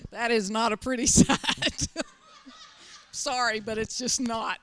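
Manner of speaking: laughing through the words. Real, not acted. Pastor joking about shaved heads, laughing. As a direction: giggle it out, amused.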